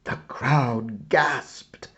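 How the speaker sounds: ordinary delivery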